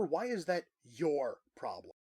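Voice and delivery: ordinary talk, nothing marked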